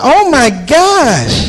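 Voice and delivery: high, slow drawn-out gasp of shock; shocked lady. Retold or imitated. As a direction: stretch the horror out.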